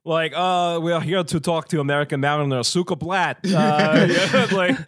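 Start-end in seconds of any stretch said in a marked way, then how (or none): 0.4-3.4 put-on Russian accent. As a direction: fake Russian accent on the radio. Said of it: "that's what they all sound like".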